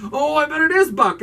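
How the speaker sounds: high, loud burst of discovery; mock realization. Viewer suddenly suspecting Buck, mock excited realization. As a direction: leap up high as if suddenly cracking the case.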